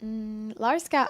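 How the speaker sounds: usual speaking voice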